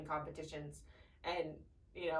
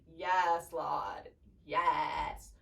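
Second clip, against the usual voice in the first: retold relieved yes; 3 semitones lower, much slower than usual. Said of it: "I was like".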